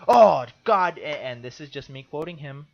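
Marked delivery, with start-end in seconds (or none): 0.1-1.0 loud, higher, exasperated outburst; freaking out. Retold or imitated. Quoting a voter handed a new ballot, freaking out. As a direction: imitate a man at his wits' end. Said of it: "this guy's freaking out".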